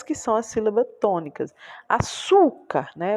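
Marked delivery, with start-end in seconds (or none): 1.9-2.9 Açúcar slowed, with a high, drawn-out "çú"; stress on middle syllable. Shown as a demonstration. Teacher pointing out the tonic syllable, high stress on "çú" in Açúcar. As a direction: lift and stretch the "çú" of Açúcar. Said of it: "que é a sílaba tônica".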